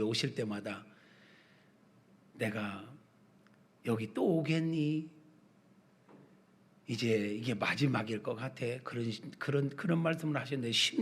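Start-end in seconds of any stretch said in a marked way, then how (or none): 2.3-8.7 soft, lightly voiced weary old father; an aged parent's quiet, slowed musing; elderly father. Father visiting his child's house for maybe the last time, softly resigned. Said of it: "우리 아버지가".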